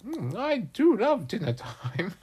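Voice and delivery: high, squeaky fly voice; small, high cartoon insect; contented fly. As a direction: relish it as a tiny greedy fly. Fly relishes the bone.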